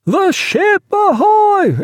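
high, drawn-out shout; long, carrying sailor's call; ship's hail. A narrator's reading. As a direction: hail another vessel across the water.

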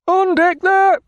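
high, loud call from the masthead; lookout hailing. Acted. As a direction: call down from aloft, unhurried. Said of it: "hailed the lookout from on high in an unemphatic tone".

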